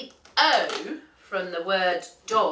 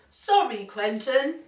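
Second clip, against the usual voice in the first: raised, lightly voiced animal chorus; apologetic farm animals; 6 semitones higher than usual. A narrator's reading. Farm animals giving up on helping the duck, lightly apologetic. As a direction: apologise in a slightly put-on higher voice.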